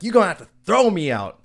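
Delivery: high, loud mock challenge; belligerent defiance. Retold man repeats his defiance.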